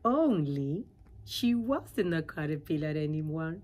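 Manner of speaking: ordinary delivery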